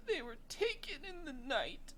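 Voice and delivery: put-on high, small girl's voice; child's voice from the narrator; little girl voice. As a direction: pitch way up like a scared little girl. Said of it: "and she goes".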